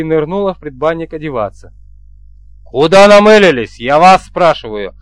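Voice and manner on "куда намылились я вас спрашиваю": loud burst that drops in pitch; raging demand. A narrator's reading. Chief berating his two men, raging. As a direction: hit the first words hard, angrily. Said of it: "продолжал бушевать я".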